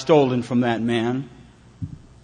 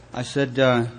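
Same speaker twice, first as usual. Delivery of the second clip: usual speaking voice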